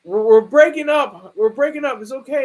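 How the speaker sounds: breaking up. Retold or imitated. Man telling his girlfriend they're breaking up, mock hurt. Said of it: "he was like".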